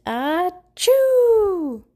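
loud high acted sneeze. A narrator's reading. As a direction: let out a big sneeze. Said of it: "Suddenly, Pablo sneezed".

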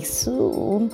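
plain reading voice

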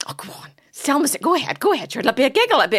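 high, loud, eager egging-on; quick, excited mock pleading; imitated coaxing husband. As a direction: coax like a giddy husband.

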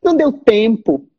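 high, drawn-out mock whine; hammed-up excuse, pitched well up; complaining student. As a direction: imitate a student making a lame excuse. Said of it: "e sair falando assim".

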